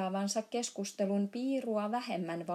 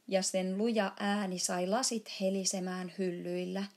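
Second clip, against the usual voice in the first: usual speaking voice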